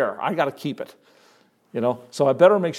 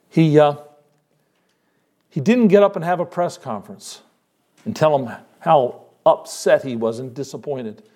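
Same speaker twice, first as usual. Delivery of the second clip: ordinary talk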